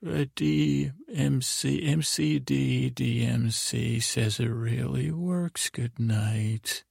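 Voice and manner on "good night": ordinary delivery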